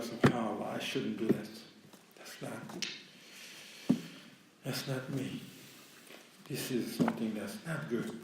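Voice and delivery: low, hushed talk to oneself; quiet self-reproach. Acted. Believer realizing he did wrong, quiet self-reproach. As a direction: murmur it inwardly, with soft regret.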